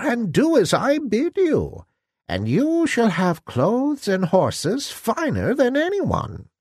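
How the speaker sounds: put-on high-pitched old fairy godmother, commanding yet kind; croaking old woman. A narrator's reading. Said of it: "said the old woman".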